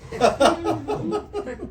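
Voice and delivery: a high, scornful cackle at Krishna; mocking high laugh. Proud Brahmin friend mocking Krishna, high scornful laugh. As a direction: laugh down at the cowherd boy. Madhu Mangal mocks Krishna.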